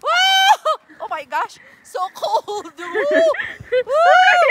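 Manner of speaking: high loud woo; excited whooping. Real, not acted. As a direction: whoop with cold thrill. Cold snow excitement.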